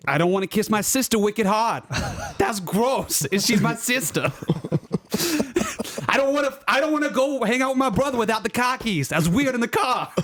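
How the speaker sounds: put-on Boston accent. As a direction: ham up a Boston accent through laughs. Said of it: "let's do the Boston accent for thirty seconds here".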